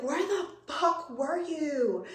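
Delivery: slow, high mock wail of relief and upset; retold frantic scolding, not shouted; distressed cry. Frantic owner confronts returned dog.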